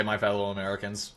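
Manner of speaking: low, soft presidential voice; Obama. Acted. Streamer rehearsing for the impression, low presidential voice. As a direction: try the president's opening under your breath. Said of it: "I need to hear Obama say".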